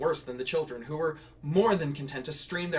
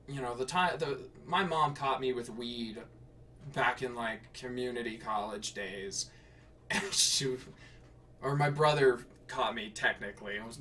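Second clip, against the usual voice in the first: usual speaking voice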